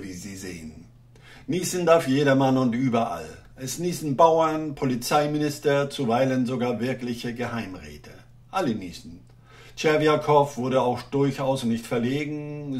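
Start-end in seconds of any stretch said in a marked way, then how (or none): none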